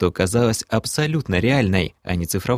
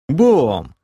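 reproachful; 5 semitones higher, 5 dB louder than usual. Acted. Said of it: "укоризненно произнёс я".

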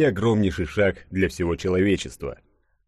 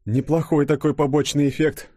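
amused irony, pitched up; wry, higher-voiced appreciation; smirking; 8 semitones higher than usual. A narrator's reading. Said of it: "ухмыльнулся Денис".

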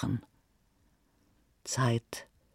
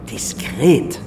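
Diskret said again, firmly and louder; louder repeat; 6 dB louder than usual. Acted. Billionaire on the phone repeats Diskret firmly and louder.

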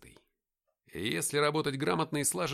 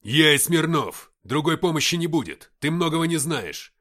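loud, raised radio yell; shouted reply; 4 semitones higher, 12 dB louder than usual. Acted. Ignat answering the captain over radio, shouting. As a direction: shout firmly over the din.

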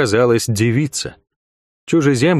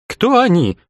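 much higher confused question; puzzled; 11 semitones higher, slower than usual. A narrator's reading. Young commander not understanding, high puzzled question. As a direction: ask it baffled. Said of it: "не понял молодой командир".

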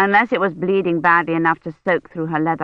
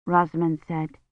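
ordinary delivery, nothing marked

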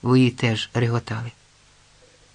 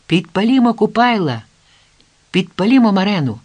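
raised, rallying cry to a crowd; calling out; 7 semitones higher, 5 dB louder than usual. Acted. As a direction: call out high and loud, urging everyone on. Crowd calls to burn the idols.

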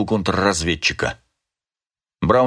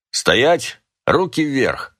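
mildly raised, higher-pitched call; perky command, understated shout; spirited, ringing shout; 5 semitones higher, much slower than usual. A narrator's reading. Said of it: "прокричал кто-то задорно и звонко". (задорный, звонкий окрик)

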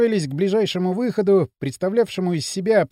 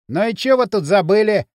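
raised, loud street-bully challenge; mocking; 4 semitones higher, 5 dB louder than usual. A narrator's reading. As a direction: needle the intruders with a taunt. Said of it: "насмешливый, знакомый до отвращения голос". (громко, с издёвкой)

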